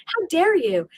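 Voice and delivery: retold indignant comeback; offended retort. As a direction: act affronted by criticism. Retold offended reply to friend.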